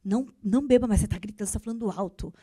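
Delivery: low, stern telling-off, not shouted; bossy scolding. Acted. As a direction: order them to stop, voice kept down. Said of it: "Existe uma grande diferença em eu falar assim".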